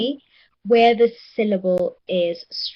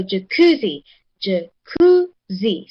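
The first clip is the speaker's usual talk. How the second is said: "jacuzzi" with the second syllable stressed, ja-KU-zi; 2 semitones lower, 4 dB louder, slower than usual. Shown as a demonstration. Teacher shows class the stressed syllable, "jacuzzi" as ja-KU-zi. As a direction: break up "jacuzzi" and lean on the middle, ja-KU-zi. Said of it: "the one that receives the stress".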